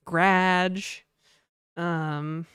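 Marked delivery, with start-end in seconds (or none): 0.1-1.0 "garage" as one syllable, "gradge"; "garage" with its first vowel gone, "graaj"; not fully pronounced. Shown as a demonstration. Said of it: "do not pronounce things in their entirety".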